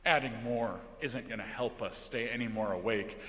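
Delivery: ordinary delivery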